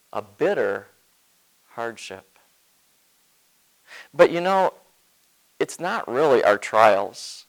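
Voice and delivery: ordinary talk, nothing marked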